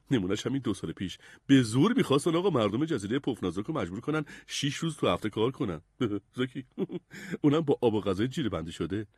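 chatty sailor with chuckles; good-humoured, scoffing old hand; jolly sailor. Acted. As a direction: explain casually, chuckling between jibes. Fat jolly sailor explains.

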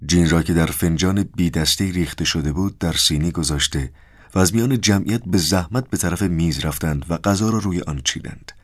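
usual speaking voice

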